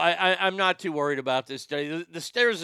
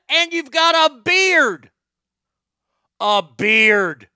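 high, loud outburst on "beard"; exasperated, loudly stretched "beard"; incredulous shout; 6 semitones higher, 11 dB louder, much slower than usual. Real, not acted. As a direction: shout it in comic disbelief. Rant peaks in disbelief.

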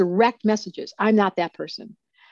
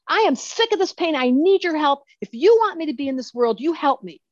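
fed-up demand; 7 semitones higher than usual. Retold or imitated. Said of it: "I was like".

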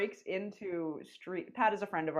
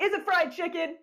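much higher and louder, teasing question; mock-innocent tease; 10 semitones higher, 5 dB louder than usual. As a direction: bait him with a sly question. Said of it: "And I was like".

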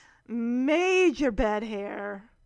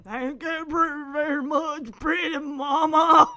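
hammed-up [name] thanks, building louder; [name] impersonator; 4 semitones higher, 6 dB louder than usual. Old impersonator thanking his honey, hammed-up [name] imitation. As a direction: do a mock [name], drawing out the last words loudly. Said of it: "And he's like".

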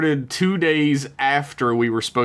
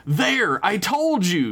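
yell; 9 semitones higher, slower than usual. A narrator's reading. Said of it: "yelled the young boy".

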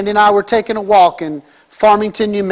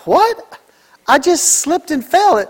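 higher-pitched, mock-puzzled protest; imitated disbelief; 6 semitones higher, slower than usual. Wife protesting to the nurse who saw her fall, imitated disbelief. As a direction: play her baffled, pitched up. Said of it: "And Sandy's like".

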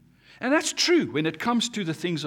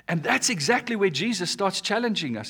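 ordinary delivery

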